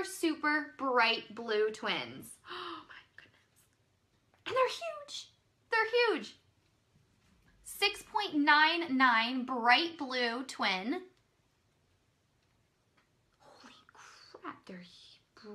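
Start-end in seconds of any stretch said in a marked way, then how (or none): 2.4-3.7 gasp, then a breathy, much softer oh my goodness; hushed and amazed, pitched up; awed gasp at the bright blue twins; gasping awe. Real, not acted. Bright blue twin pearls revealed. 4.5-6.3 much higher, squealed amazement; thrilled at the size of the pearls; amazed. Real, not acted. 13.4-15.5 stunned whisper; whispered disbelief at the huge pearls; whispered awe. Real, not acted.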